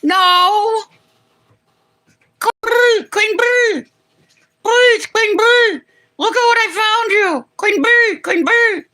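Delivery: high, loud honking bird call; stately honking crane. Pretend. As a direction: honk it out like a crane. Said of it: "did that sound like a whooping crane".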